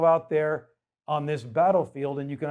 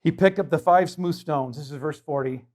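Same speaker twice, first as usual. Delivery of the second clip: normal talking voice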